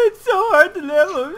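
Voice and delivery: high, whining mock of a friend; whiny mock voice. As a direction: whine it like a crybaby friend. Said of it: "That's you".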